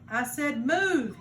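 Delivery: loud, slow angry bark; guard yells at frozen kids, slow loud; yelling. Acted. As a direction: make them jump. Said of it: "leaned toward them and yelled".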